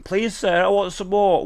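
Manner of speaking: put-on high pleading child voice; begging orphan. Acting Oliver Twist begging.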